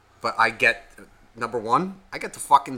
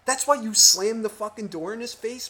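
high-pitched, rising rhetorical questions; incredulous; 9 semitones higher than usual. Retold or imitated. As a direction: challenge a friend's ridiculous complaint. Retelling his disbelief at the complaint.

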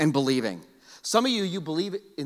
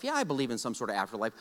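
mock casual believer; 7 semitones lower, 7 dB quieter, faster than usual. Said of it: "Some of you".

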